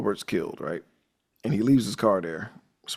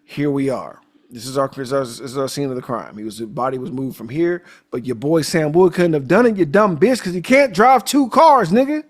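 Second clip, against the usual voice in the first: building rant; 4 semitones higher, 6 dB louder than usual. Retold or imitated. Detective laying out the crime to Gillespie, building to a loud angry shout. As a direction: build from explaining to yelling at the fool. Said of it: "He's like".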